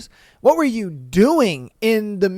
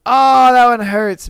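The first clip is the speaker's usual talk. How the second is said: louder, drawn-out pained reaction, a touch slower; pained groan; 5 semitones higher, 7 dB louder, slower than usual. Real, not acted. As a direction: let the hurt come out as a loud, slow groan. Reacting to a sad story.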